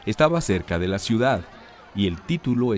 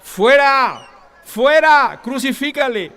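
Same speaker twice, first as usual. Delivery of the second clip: much higher, louder furious mob yell; crowd shouting; 11 dB louder, slower than usual. A narrator's reading. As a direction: scream it as an enraged crowd. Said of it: "Pero ellos gritaron".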